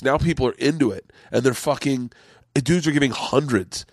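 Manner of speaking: ordinary talk, nothing marked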